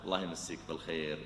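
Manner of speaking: very soft, low kind wish; gentle blessing. Acted. Preacher answering anger with a very soft blessing. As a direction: bless him softly.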